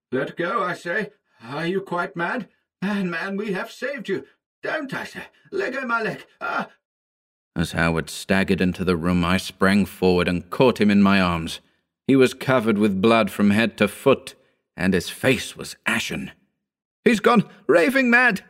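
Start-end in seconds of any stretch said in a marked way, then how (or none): 0.1-6.7 high-pitched frantic protest, held back in volume; wild expostulation. A narrator's reading. Man heard from the kitchen fighting off his maddened friend, wild expostulation. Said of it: "the voice of Howard raised in wild expostulation". 17.1-18.4 high, anguished moan. A narrator's reading. Wounded Howard staggering in from the kitchen, moaning. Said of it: "he moaned".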